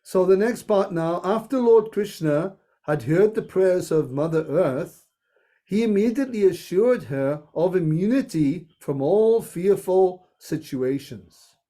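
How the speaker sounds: ordinary talk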